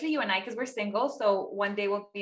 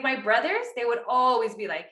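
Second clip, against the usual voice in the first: normal talking voice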